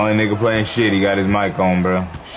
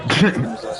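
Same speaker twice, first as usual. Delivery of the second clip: mocking laugh; 5 semitones higher than usual. Real, not acted. Mocking a losing teammate.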